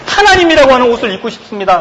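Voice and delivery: lightly voiced pleading confession; confessing believer. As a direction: beg to wear God's garment. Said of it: "라고 고백한 거죠".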